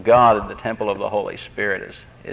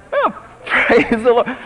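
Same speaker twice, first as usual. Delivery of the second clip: laughing praise; 11 semitones higher, slower than usual. Real, not acted. Laughing joy at gift.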